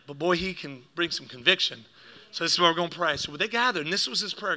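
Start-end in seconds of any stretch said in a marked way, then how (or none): none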